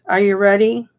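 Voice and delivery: ordinary talk, nothing marked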